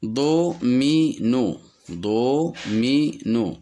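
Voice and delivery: "domino" slowly split into do, mi, no; syllable by syllable. Shown as a demonstration. Teacher counting syllables in a word, syllable by syllable. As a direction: give each syllable of "domino" its own beat. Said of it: "Le mot domino contient deux syllabes".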